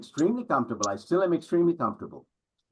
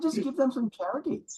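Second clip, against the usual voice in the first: dismissive father; 10 semitones higher than usual. Retold or imitated.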